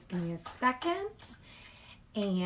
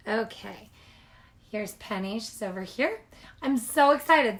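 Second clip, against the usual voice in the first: usual speaking voice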